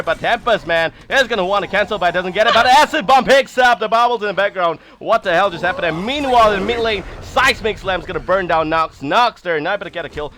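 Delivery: fast, loud hyped esports call; excited play-by-play. Real, not acted. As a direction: call the fight at full hype.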